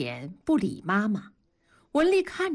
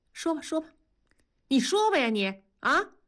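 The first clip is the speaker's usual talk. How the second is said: high, exasperated prodding; suppressed anger; 7 semitones higher than usual. A narrator's reading. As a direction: press for an answer, holding anger back. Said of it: "压住怒气说". (压着怒气催问)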